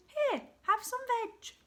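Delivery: very high, jolly hoot; hooted offer. A narrator's reading. Duckbills offering Drip food, high hooting. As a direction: hoot it out as a welcome. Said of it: "And they hooted".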